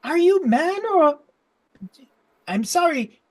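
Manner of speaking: high, curious gnome voice; inquisitive gnome. Acted. Gnome questions what visitor is, high inquisitive voice. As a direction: question the stranger in a high pitch. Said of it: "And he goes".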